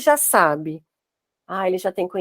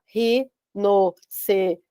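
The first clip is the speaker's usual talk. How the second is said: rinoceronte broken slowly into syllables ri-no-ce-ron-te; split into syllables; 2 semitones lower, much slower than usual. Shown as a demonstration. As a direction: spell out rinoceronte syllable by syllable, very slow. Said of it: "partir em sílabas". (rinoceronte dividido em sílabas, bem devagar)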